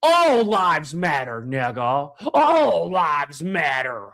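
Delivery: slow, low, drawn-out mimicry; mocking objector. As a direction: drag it out in mock protest.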